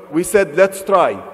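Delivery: ordinary talk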